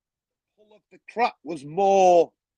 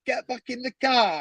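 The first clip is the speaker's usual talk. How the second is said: higher, incredulous and impatient; mock exasperation at a useless mate; exasperated; 7 semitones higher than usual. Telling his panicking mate to get back in the car, exasperated.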